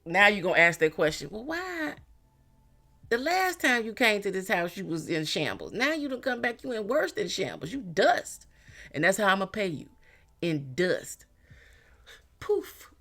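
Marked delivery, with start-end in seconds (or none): 1.3-11.2 high, scornful sing-song put-down; much higher, mocking brush-off to a begging ex; mocking comeback. Client mocks the returning ex. 12.4-12.9 high, squeaky dismissive poof; much higher playful vanishing poof; mock poof. Dismissing the ex with a flourish.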